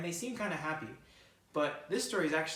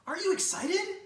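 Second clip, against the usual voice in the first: very high, louder eager question; chirpy bird; 6 dB louder than usual. Acted. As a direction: coax a grumpy friend. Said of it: "Bird chirped".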